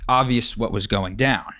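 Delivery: normal talking voice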